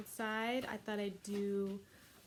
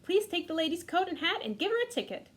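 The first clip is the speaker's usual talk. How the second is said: higher, fast bossy orders; bossy sister; 8 semitones higher, 3 dB louder, faster than usual. Acted. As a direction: rattle off the job.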